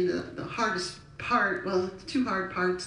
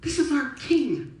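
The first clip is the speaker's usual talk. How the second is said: raised, slow, emphatic proclamation; talks like Jesus; 4 semitones higher, 4 dB louder than usual. Shown as a demonstration. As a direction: proclaim it high and drawn out. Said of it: "Jesus talks like this".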